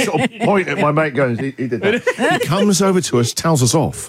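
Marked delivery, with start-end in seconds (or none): 1.4-2.4 slow, laughing, guilty pointing; sheepish laughing blame. Retold or imitated. Host pointing at his mate to the angry star, laughing sheepishly. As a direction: laugh while shifting the blame. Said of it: "Jeff meekly puts his hand up and I'm like".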